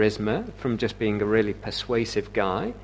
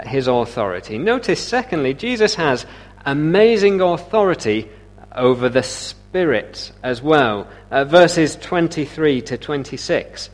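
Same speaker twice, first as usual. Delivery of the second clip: ordinary talk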